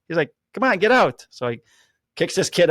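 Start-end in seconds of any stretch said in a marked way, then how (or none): 0.5-1.2 higher, louder impatient order; pushy mock command; retold call-out. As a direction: imitate someone shooing a player out. Acting coach ordering player out.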